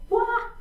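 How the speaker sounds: short, high "wah" yelp; playful "wah". Real, not acted. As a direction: pop out a quick high "wah". Playful noise while balancing the leg.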